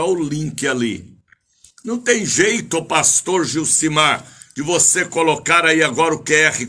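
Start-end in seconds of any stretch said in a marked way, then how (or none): none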